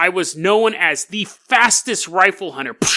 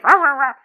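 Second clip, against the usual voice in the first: short high imitated barks; dog barking; 8 semitones higher, 3 dB louder than usual. Dog answering its owner, high mock barking. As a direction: bark back like an excited dog. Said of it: "Dog's like".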